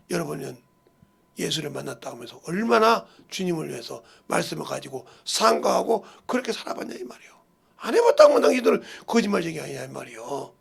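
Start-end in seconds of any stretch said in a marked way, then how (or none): none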